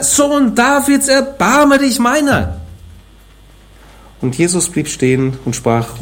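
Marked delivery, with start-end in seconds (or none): 0.0-2.6 very high, slow desperate cry; crying out louder. Acted. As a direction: stretch every word into a pleading wail. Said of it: "Er aber schrie umso mehr".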